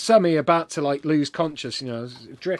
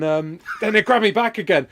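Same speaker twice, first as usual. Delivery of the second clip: usual speaking voice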